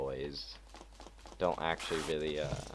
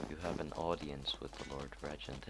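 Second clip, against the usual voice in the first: softer, lifeless guard drone; dead sounding voice; 7 dB quieter than usual. Shown as a demonstration. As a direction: drain all life out of it. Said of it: "How do you make a dead sounding voice?".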